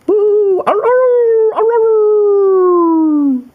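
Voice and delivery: very high, loud whooping howls; Larry's howl. Acted. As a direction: howl and dance like a funny pup. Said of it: "when Larry howled".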